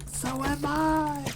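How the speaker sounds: high, slow, dismayed cartoon kid voice; Milhouse's reply. Milhouse answering Bart, high and slow. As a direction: stretch it out, wide-eyed and high. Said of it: "From The Simpsons".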